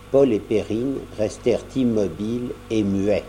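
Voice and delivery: ordinary delivery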